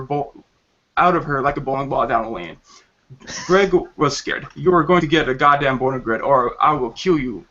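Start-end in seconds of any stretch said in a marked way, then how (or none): none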